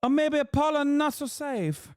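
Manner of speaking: high, hammed-up Italian accent; mock Italian accent. Streamer mocking an Italian tourist, high Italian accent. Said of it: "Now I'm saying".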